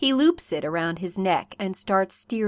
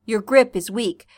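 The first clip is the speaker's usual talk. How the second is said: plain reading voice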